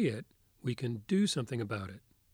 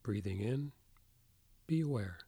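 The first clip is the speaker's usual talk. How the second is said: soft spa whisper; 4 dB quieter than usual. Acted. Leads a guided meditation.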